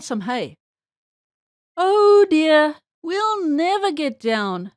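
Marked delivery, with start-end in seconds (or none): 1.8-4.7 loud, high "oh dear", then slowing; sighing dismay. A narrator's reading. Nurse Jane stuck up in the air, sighing in dismay. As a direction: lament it with drawn-out words. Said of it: "sighed Nurse Jane".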